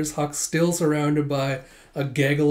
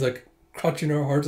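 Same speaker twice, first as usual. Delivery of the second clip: ordinary talk, nothing marked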